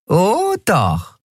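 high, gloating little villain; mean, teasing comeback in a high voice; nasty grin. A narrator's reading. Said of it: "Nelen grinste fies".

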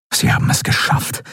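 whispered relief; relieved. A narrator's reading. Admiral Thelios relieved that the ship escaped. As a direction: breathe it out in relief.

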